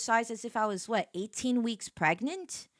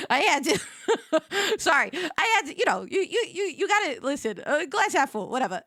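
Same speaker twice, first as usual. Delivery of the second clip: laughing; 5 semitones higher than usual. Real, not acted. Laughing through words.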